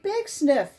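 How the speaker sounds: ordinary delivery, nothing marked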